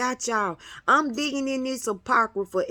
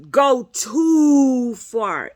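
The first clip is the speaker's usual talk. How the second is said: drawn out slowly; 2 semitones higher, 5 dB louder, much slower than usual. Real, not acted. Stretched words.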